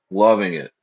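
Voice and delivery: ordinary talk, nothing marked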